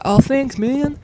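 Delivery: high, bright modest thanks; humble thanks. Retold or imitated. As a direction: act bashfully flattered. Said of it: "And I'll be like".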